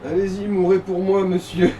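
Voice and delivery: usual speaking voice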